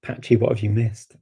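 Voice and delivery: normal talking voice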